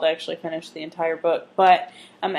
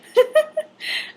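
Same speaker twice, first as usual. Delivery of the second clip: laugh. Real, not acted. Laugh in talk.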